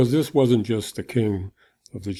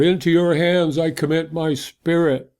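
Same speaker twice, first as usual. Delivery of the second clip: raised, pleading cry to heaven; 6 semitones higher than usual. A narrator's reading. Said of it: "he cried out".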